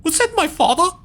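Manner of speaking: put-on high, pleading boy's voice; young character voice. A boy searching for his father, calling high and anxious. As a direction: call out high for your lost father.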